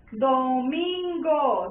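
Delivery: "Domingo" very slow and stretched, loud on "min"; stress on MIN. Shown as a demonstration. Teacher asking where the stress is heard, stressing "min" in "Domingo". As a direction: draw out "Domingo" so "min" stands out. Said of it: "con mayor intensidad la sílaba".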